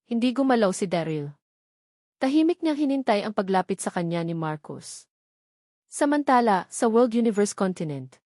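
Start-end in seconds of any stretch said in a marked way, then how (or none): none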